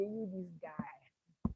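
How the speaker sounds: slow, breathy whisper of a scheming devil; menacing whisper. Retold or imitated. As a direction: whisper it slowly like a threat. Lucifer taunting God.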